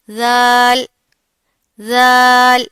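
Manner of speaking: ذال said gently, not harsh; polite zaal. Shown as a demonstration. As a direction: soften ذال, no hiss. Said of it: "بہت politely پڑھنا ہے". (نرمی سے ذال)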